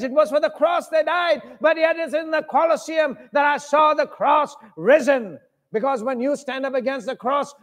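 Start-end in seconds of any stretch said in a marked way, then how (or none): 0.0-5.4 full-voiced preaching climax; preaching shout. Real, not acted. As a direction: cry it out to the crowd.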